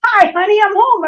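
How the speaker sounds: high, loud, exuberant hello; excited greeting. Retold or imitated. Husband bursting in to his wife, loud and excited. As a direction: burst through the door with joy. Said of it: "throws me over his knee".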